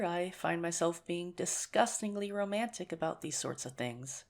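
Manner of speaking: ordinary delivery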